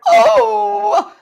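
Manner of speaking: loud, high acted holler; a sudden loud yelp. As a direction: cry out as the toe gets bitten. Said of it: "I kicked and cried and hollered".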